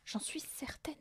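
soft conspiratorial whisper. A narrator's reading. Mathilda sure burglars are next door, whispering. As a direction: whisper it with certainty. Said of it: "chuchota Mathilda".

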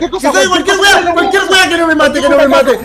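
loud, high, frantic begging; súplica eufórica. Real, not acted. Begging the game during bonus. (rogando a gritos, desesperado y emocionado)

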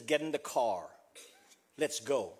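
low, soft, firm command; retold order, dropped in pitch and volume; quiet order.

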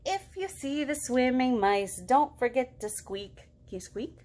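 usual speaking voice

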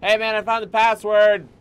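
loud, high call across the room. Real, not acted. Gamer calling out to a game character, loudly. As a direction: shout it over to the guy.

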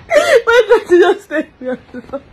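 ordinary talk, nothing marked

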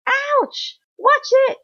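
high, loud yelp; squealed protest; pained cry. A narrator's reading. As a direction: cry out as if stepped on. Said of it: "Sticky, sticky insect cried".

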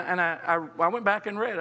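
normal talking voice

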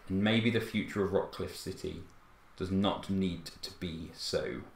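booming British herald voice, in command; authoritative British announcer. Acted. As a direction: announce the takeover like a calm, resolute official. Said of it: "and he speaks again".

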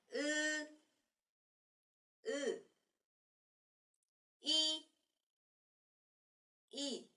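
high, drawn-out Korean ư and y; model vowels. Shown as a demonstration. Teacher shows mouth shape to students, clearly. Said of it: "phát âm giống như cái miệng của mình nha".

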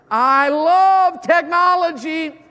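high, loud, slow gush of love; drawn-out gush. Real, not acted. Preacher gushing to teens, high, loud and slow. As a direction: stretch it out with delight.

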